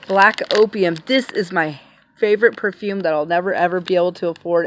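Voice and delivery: usual speaking voice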